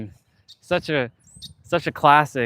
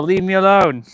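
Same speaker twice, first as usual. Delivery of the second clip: louder, whiny shoo; exasperated shooing; 5 semitones higher, 6 dB louder than usual. Real, not acted. Vlogger shooing bugs, loud exasperated plea. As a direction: tell them off with a groan.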